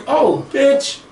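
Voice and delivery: a high, scornful oh, bitch; scoffing. Acted. Mocking a warlord who brags about his army, high scornful scoff. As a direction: scoff at the boast.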